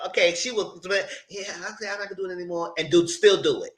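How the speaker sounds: ordinary delivery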